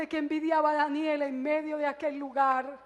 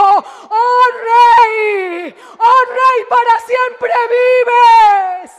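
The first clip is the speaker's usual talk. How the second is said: loud, high shout calling up to the king; shout from the pit; 8 semitones higher, 15 dB louder, much slower than usual. Retold or imitated. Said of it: "un hombre gritó". (grito triunfal desde el foso)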